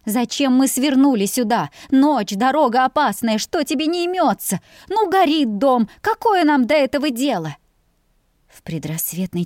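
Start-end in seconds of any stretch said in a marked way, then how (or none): 0.1-7.6 lightly higher, uneasy woman's voice; a wife fretting at her husband's detour; worried wife. A narrator's reading. Wife nagging husband at night, mildly raised worried voice.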